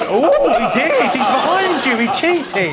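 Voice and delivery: high, slow panto heckle; panto audience. Retold or imitated. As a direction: call out like a panto crowd. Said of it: "people in the audience going".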